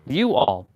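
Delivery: "you all" in two distinct words; teacher's correction. Retold or imitated. Teacher attempts to correct Steve, correcting "you all". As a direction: imitate a correction, parting "you all" clearly. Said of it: "she was attempting to correct him".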